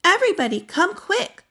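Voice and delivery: high excited call to everyone; urgent, high summons to come quick. A narrator's reading. Boy calling family to see the puppies, excited and high. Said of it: "called Arthur".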